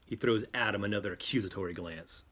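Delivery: usual speaking voice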